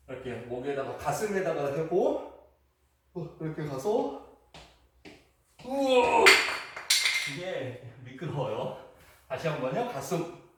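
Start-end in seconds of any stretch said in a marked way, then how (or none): 5.6-6.2 louder, higher yelp of surprise; startled cry. Real, not acted. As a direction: caught off guard by the slip. Nearly falls on slippery clothes. (깜짝 놀란 외침)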